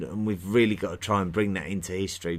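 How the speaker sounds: ordinary delivery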